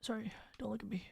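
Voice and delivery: quick unvoiced whisper. Player sneaking by the monster, quick whisper. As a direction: whisper it like creeping past.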